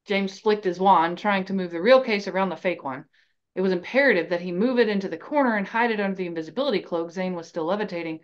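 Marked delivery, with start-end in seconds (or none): none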